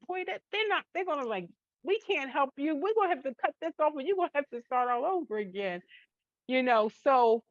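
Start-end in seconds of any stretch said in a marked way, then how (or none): 0.1-5.8 lightly voiced stylist, higher and fast; hairstylist verdict. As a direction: rattle it off like a stylist with bad news. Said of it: "I'm like".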